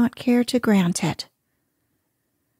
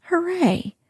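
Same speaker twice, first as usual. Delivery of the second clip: excited shout; 8 semitones higher than usual. A narrator's reading. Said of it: "shouted Bud".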